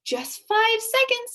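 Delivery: put-on high, eager boy's voice; bursting with excitement; excited young boy, loud and high. A narrator's reading. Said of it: "said Milo excitedly".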